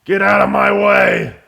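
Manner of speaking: slow, loud barked order; hammed-up bully yelling get out of the way; mock thug shout. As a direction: shove him aside with a yell. Thug yells at butterfly.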